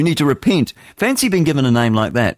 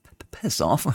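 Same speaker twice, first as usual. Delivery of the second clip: mock muttered retort; 7 dB quieter than usual. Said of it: "No, I said".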